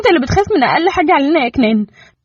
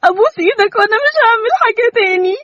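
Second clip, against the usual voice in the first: much higher, weeping pleas; in tears; 12 semitones higher than usual. Acted. Mother begging Kenan through tears to bring back their son. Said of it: "وقالت بدموع".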